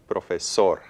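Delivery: "profesor" stressed correctly as pro-fe-SOR; stress on last syllable. Shown as a demonstration. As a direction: land firmly on SOR in "profesor". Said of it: "putting stress on all three syllables".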